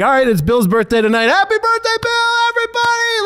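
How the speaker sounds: high, whooping call to the whole restaurant; rally the room for a birthday, rising to a high holler; acted-out party shout, pitch soaring; rowdy birthday announcement.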